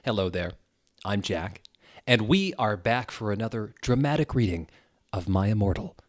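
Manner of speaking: usual speaking voice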